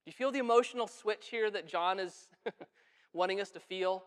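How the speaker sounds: ordinary talk, nothing marked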